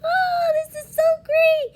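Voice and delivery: high, bright-eyed delight; happy voice. Shown as a demonstration. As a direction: gush like you just got great news. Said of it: "talk like".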